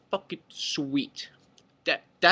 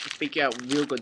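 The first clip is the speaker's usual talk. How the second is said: ordinary delivery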